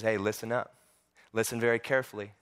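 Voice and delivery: low, hushed gravity; very serious. Acted. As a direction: drop the voice and demand close attention. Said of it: "Jesus gets very serious".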